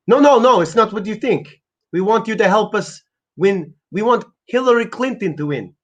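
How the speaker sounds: put-on high-pitched accented salesman; urgent high voice with a thick foreign accent; pushy foreign dealmaker. Pitching a candidate to a doubter, pushy accented sales patter. Acts a political pitchman character.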